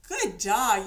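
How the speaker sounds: loud, happy praise for the dog; praising the puppy. Shown as a demonstration. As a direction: reward him with a bright, loud cheer. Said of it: "And if the puppy goes".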